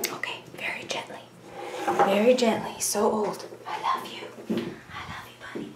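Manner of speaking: soft tender whisper; whisper to cat. Real, not acted. Lowering her cat gently, whispered love. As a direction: breathe the words to the cat.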